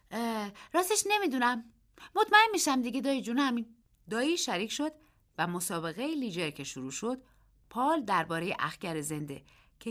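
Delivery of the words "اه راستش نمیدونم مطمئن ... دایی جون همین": put-on a restless boy, higher and hurried; fidgety boy rushing to answer. A narrator's reading. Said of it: "پسر با بیقراری گفت".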